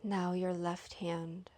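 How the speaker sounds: unhurried, even meditation voice, lingering between phrases; guided meditation. Real, not acted. Leads guided yoga nidra.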